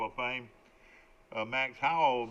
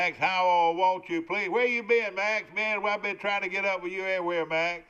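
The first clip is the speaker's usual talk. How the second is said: excited greeting; 6 semitones higher, 5 dB louder than usual. Real, not acted. Radio host hollers an excited hello to caller Max. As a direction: call out his name like a long-lost buddy.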